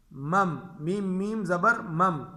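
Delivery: slow spelling, مم hummed; مم spelled with the small meem. Shown as a demonstration. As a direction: spell it out slowly, humming مم. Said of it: "چھوٹے میم کو لے آئیں گے".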